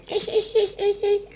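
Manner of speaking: mock girlish giggle, very high and soft; imitating the coy marketing girl's giggle. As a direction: squeak out a shy little giggle. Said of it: "E ela assim".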